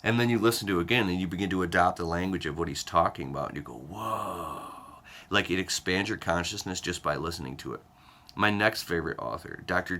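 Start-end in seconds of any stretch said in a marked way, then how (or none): 3.7-4.7 long, drawn-out awed whoa; amazed. Acted. Acting out understanding the book on second listen, amazed. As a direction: stretch it out as if your mind just opened.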